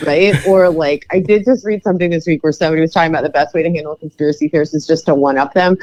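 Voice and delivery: usual speaking voice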